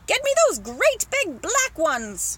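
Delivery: loud, high, slow demand; shouting. Acted. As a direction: bellow the order word by word. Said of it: "the man was shouting".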